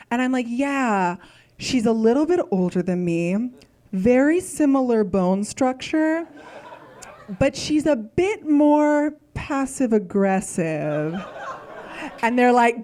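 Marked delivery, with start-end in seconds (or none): 0.5-11.1 slow, drawn-out, delicate description; careful hedging. Retold or imitated. Describing her mom to restaurant staff, slow and carefully euphemistic. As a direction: pick each phrase carefully, hinting slowly.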